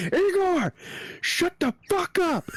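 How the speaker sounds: high-pitched imitation of a frustrated yell; mock scream. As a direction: act out snapping at a noisy dog. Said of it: "scream at him like that".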